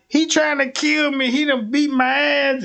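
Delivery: high, loud mock scream for help; a girlfriend yelling to the cop. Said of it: "she screaming out".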